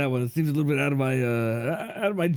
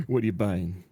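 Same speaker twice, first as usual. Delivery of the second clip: low gruff shopkeeper thug; gangster character voice; 8 semitones lower than usual. Acted. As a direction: growl it low like a shady dealer. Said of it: "here's my character concept".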